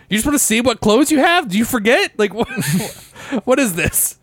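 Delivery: high, mock-baffled questioning; incredulous. Retelling teasing a friend about his outfits, high mock disbelief. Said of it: "I was just like".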